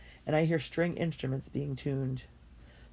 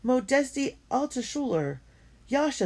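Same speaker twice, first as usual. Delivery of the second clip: joyful reunion; 10 semitones higher, 4 dB louder than usual. Acted. Old friends reunite joyfully.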